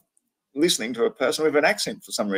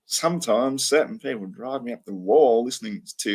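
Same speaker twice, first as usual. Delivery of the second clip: ordinary delivery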